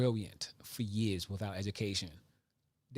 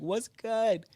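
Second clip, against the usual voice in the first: daughter greeting; 10 semitones higher, 4 dB louder than usual. Retold or imitated. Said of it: "be like".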